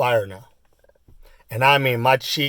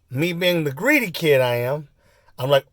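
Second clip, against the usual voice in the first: ordinary delivery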